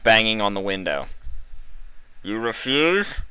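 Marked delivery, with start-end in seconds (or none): 2.2-3.2 a slow, higher, laughing sneer; mocking. A narrator's reading. Interrogator over the speaker, mocking her. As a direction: scoff at her refusal.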